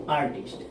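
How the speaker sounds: आर्टिस्ट said with open vowel आ at the start; pronunciation demo. Shown as a demonstration. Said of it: "इसका pronunciation करेंगे तो आर्टिस्ट".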